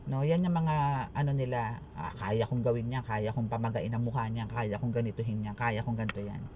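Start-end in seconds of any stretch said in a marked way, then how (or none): 1.9-6.4 low, lightly acted bragging; mock boasting. Reader imitates the enemies' boasts, mock bragging. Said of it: "Yun ang yabang nila eh".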